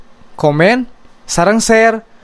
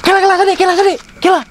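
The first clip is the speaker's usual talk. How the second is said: calling out; 8 semitones higher, faster than usual. Real, not acted. Calling friend to wait.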